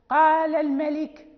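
loud recitation halting on الملك; recitation broken off. Shown as a demonstration. Teacher showing a breath break mid-verse, loud recitation cut short. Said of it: "انقطع النفس".

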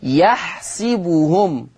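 يحسبهم as "yahsibuhum", i after the s; يحسبهم with a clear short i on the sin; kasra on sin. Shown as a demonstration. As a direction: slowly say يحسبهم with si in place of sa. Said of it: "ورش يقرأ بكسر السين".

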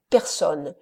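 ordinary delivery

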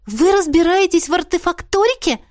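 high, delighted question; admiring. A narrator's reading. Heroine learns the commander can repair artifacts, admiring. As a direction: ask in amazed admiration. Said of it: "восхитилась я".

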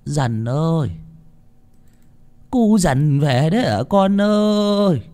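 put-on high, slow old mother's call; old woman calling her son, high and slow. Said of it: "Bà ấy lẩm bẩm".

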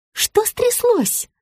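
high-pitched, slow alarmed question; frightened. A narrator's reading. Rita reacts to the caller's squeal, frightened. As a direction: gasp it out in worry. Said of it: "испугалась Рита".

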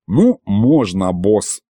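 slow, reluctant consent; wincing reluctance. A narrator's reading. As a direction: drag it out in a lightly put-on voice. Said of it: "поморщился Аристофан". (нехотя, морщась)

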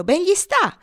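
high, loud gloating cry; crowing rooster. A narrator's reading. Rooster gloats at the punished boy, high crowing. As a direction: crow it triumphantly, way up high. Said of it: "cantò il gallo".

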